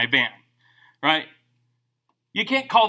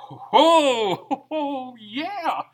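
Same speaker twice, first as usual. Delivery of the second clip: knowing oh yeah; 6 semitones higher, much slower than usual. Acted. Remembering church food bank.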